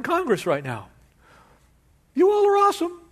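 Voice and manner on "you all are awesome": very high, loud mock praise; fawning politician. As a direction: squeal out the fake compliment. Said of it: "It's kinda like".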